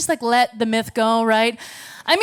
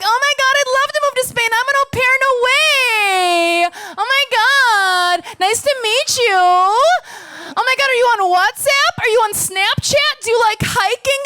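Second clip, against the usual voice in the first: put-on high, bubbly oversharing American girl; squealing, chatty American tourist voice; valley girl gush; 9 semitones higher than usual. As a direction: gush like an overexcited American abroad. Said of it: "She's like".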